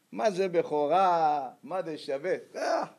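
acted contempt, words stretched out; mocking dismissal. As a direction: imitate someone waving it off as worthless. Esau scorns his birthright.

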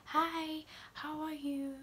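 soft, restrained little hello; simple, understated greeting. Shown as a demonstration. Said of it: "just be like a simple".

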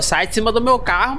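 usual speaking voice

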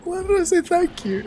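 very high squeal through laughter; helpless laughing. Real, not acted. Still cracking up at own blunder.

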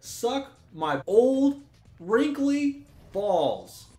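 loud, slow, drawn-out taunt; angry taunt. Real, not acted. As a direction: stretch each word out in loud anger. Angry retort to the game.

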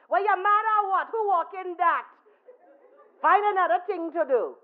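high, loud teasing scold; mock him loudly for walking; loud, high street-character voice. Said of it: "he never ever once talked softly".

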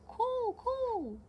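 high loud coo coo; bird call. Acted. Crow sound in storybook.

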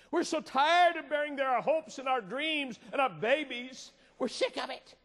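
high, loud impassioned preaching; preaching at full force. Acted. Preacher rousing the congregation, impassioned and loud. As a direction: pour it out to the congregation with fervour.

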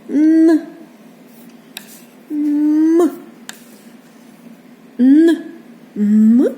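Н and М hummed long and loud, one by one; sustained consonant sounds. Shown as a demonstration. Teacher showing children the consonants, Н and М held out loudly. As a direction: hold each Н and М as a pure nasal sound. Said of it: "Ці літери позначають звуки приголосні".

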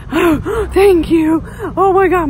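high, loud gasping relief; acting out of breath. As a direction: re-enact panting after a big scare. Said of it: "out of breath".

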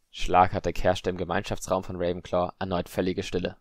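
usual speaking voice

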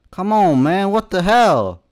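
loud, high-pitched burst of exasperation; exasperated shout. Real, not acted. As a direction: shout it at the game, fed up. Exasperated outburst at the game.

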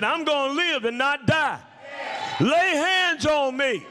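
high-pitched, ringing retold cry; defiant declaration. Sick woman refusing to die, high defiant declaration. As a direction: proclaim it high and insistently.

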